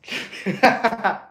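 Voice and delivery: high, helpless laugh; real high laughing fit; burst of laughter. Real, not acted. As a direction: crack up at a teasing joke. Cracking up at height joke.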